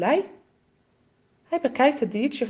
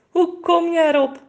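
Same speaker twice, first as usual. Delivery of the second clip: amused chuckle; 8 semitones higher, 6 dB louder than usual. A narrator's reading. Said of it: "Moeder grinnikt".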